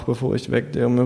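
usual speaking voice

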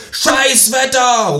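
slow, drawn-out shouted protest; loud shout. Acted. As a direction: bellow it like a lone demonstrator. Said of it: "rufe ich laut". (lauter, gedehnter Protestruf)

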